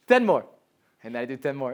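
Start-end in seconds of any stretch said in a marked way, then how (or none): 0.1-0.5 coaxing friend. Retold or imitated. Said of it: "he'd be like".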